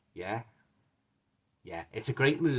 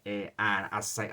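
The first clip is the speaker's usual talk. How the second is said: ordinary talk, nothing marked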